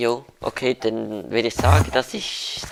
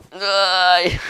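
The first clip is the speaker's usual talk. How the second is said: loud despairing no; 8 semitones higher, 8 dB louder than usual. Real, not acted. Character dies, cries out.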